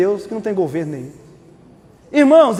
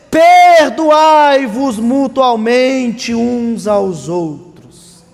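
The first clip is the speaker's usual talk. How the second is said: solemn slow reading; 4 dB louder, much slower than usual. Acted.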